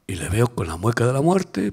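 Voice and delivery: ordinary talk, nothing marked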